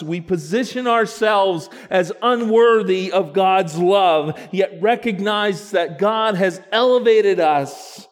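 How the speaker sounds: loud, high preaching, building; full-force preaching. Acted. Pastor rousing the congregation with full preaching. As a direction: preach it out to the church.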